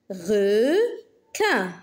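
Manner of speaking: "Requin" split into re, quin; long "re", pause, then "quin" in "Requin"; syllable by syllable. Shown as a demonstration. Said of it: "Frappe les syllabes". (« Requin » découpé syllabe par syllabe)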